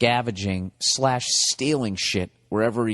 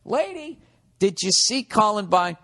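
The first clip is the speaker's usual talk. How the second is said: higher, pleading call to the mother; begging her to wake up; exasperated plea; 9 semitones higher than usual. Retold or imitated. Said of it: "And it's literally like".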